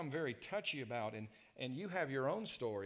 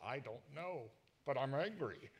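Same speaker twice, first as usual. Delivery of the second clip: retold anger; 2 semitones higher than usual. Husband answering his wife in a retold quarrel, mock anger. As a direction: act out a baffled, angry husband. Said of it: "Why are you so angry?".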